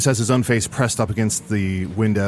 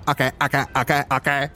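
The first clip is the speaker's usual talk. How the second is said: Joe Pesci impression; 5 semitones higher, much faster than usual. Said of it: "is actually Joe Pesci".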